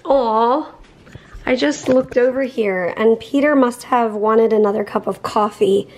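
ordinary talk, nothing marked

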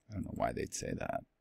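quick whispered aside. Real, not acted. Teacher wonders about the verse under his breath, whispering. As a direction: drop to a breathy throwaway remark. Muttered aside after reading.

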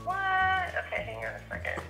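usual speaking voice

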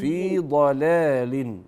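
في ضلال drawn out slowly as a model; teacher models في ضلال slowly, each vowel clear; slow model recitation. Shown as a demonstration. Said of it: "اخطفي الفتحة كده".

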